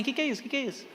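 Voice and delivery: lightly voiced, hushed puzzled teens; soft mock puzzlement; puzzled teenagers. Preacher mimics teenagers who never saw a payphone, softly puzzled. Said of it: "Os adolescentes tá assim".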